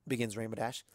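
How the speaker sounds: ordinary delivery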